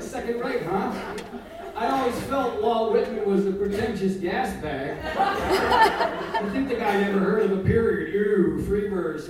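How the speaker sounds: loud, indignant mock outrage; taking it personally. Caretaker hitting back at a Whitman fan, mock offended. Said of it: "I take this very personally".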